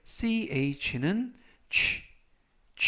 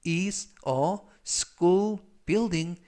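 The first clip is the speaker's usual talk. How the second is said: slow read; 2 semitones higher, much slower than usual. Shown as a demonstration. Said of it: "slow teaching read".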